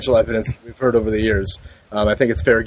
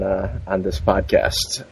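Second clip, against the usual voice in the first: dramatic slow reveal; 2 semitones lower, much slower than usual. Acted. Dramatic build-up to big topic.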